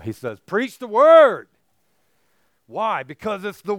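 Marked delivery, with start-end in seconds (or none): 0.4-1.5 loud, slow, forceful command; emphatic shout. Shown as a demonstration. Paul charging Timothy to preach, shouted forcefully. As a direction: boom it out as a charge. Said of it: "No, no".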